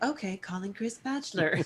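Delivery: lightly imitated digital assistant. Smart speaker confirming a call, lightly imitated assistant voice. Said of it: "She'd be like".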